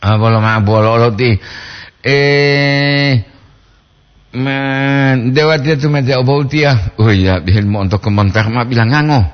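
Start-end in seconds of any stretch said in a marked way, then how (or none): none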